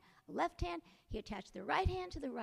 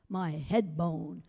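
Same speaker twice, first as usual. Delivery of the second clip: skeleton voice; 8 semitones lower, 8 dB louder, much slower than usual. A narrator's reading. Skeleton confronts Jack, lower and louder. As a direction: lower and louder bony growl. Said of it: "skeleton answers".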